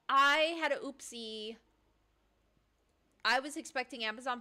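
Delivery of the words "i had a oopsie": put-on slow, loud baby talk; babyish confession. Confessing to opening mail in slow baby talk. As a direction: say it like a guilty little kid.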